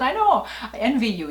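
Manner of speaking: giggling admission of envy; laughing while answering daughter. Real, not acted.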